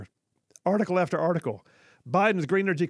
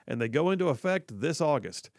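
ordinary talk, nothing marked

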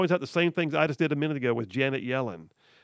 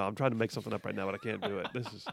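quick, much softer, through laughter; laughing; 6 semitones lower, 9 dB quieter, much faster than usual. Real, not acted. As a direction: giggle it out fast. Laughs at failed improvisation.

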